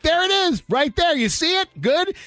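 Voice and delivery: very high, pointed vindication; indignant triumph. Retold or imitated. Customer showing staff his receipt, triumphantly indignant.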